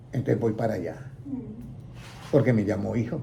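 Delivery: ordinary delivery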